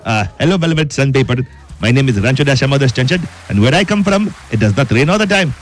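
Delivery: put-on Indian accent; Rancho. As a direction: play a comic Indian caller with careful, accented English. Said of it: "Rancho, is this how it is in your country?".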